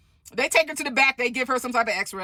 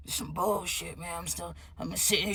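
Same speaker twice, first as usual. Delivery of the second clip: softer, lower, grumbling imitation of the stud; deep-voiced stud; 3 semitones lower, 9 dB quieter than usual. Stud complaining on the phone in the ER, deep grumble. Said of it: "her voice is getting deeper and deeper".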